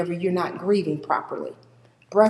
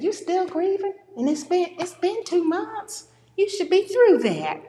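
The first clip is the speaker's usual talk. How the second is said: much higher, incredulous needling; mock impatient friend; 11 semitones higher than usual. Speaker mimicking a friend who wants grief over, mock incredulous. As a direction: needle them for still grieving. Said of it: "people that said".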